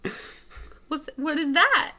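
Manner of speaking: mock-puzzled onlooker, pitch lifting; confused. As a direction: act lightly baffled, like you've never seen this before. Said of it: "One's so confused".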